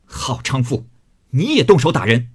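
curse, rising to a loud accusation; angry cursing. Acted. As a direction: scold furiously, punching the second phrase. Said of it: "便上来踢骂道". (怒声喝骂)

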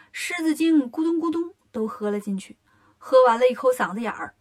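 ordinary delivery

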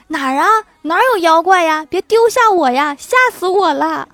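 high, loud frightened cries that keep building; 狐狸边跑边惊恐大叫; panicked fox yelling. Acted. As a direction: scream for help while running away. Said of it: "边跑边叫".

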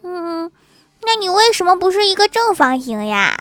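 put-on high childlike voice after a small pondering hum; puzzled little girl. Child stares at seatmate, innocently baffled. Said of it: "郭晓霞呆呆地看着女孩说".

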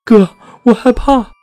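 frightened little sister, pitched very high; high-pitched, scared plea to a big brother; trembling with fear. A narrator's reading. Sister telling her brother about a ghost she saw, trembling and high-pitched. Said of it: "她颤抖地跟我说".

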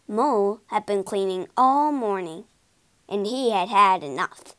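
ordinary delivery

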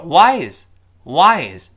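why is run together, WHY strong; linked words. Shown as a demonstration. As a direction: hit why and slide into a light is. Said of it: "连在一块儿". (why is连读，why重读)

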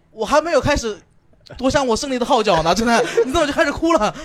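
laughing mock complaint; mock indignant outburst. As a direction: play up the outrage at being robbed of victory. Mock outrage at crying girlfriend.

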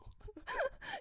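very high, breathy burst of laughter; squeaky high laugh. Real, not acted. Laughs at her boiling-water blunder.

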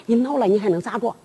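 soft, low, quick retort; hushed, rapid stage comeback; subdued defiance. Acted. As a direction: challenge him under your breath. Wife defies angry husband, first time.

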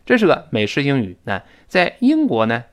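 usual speaking voice

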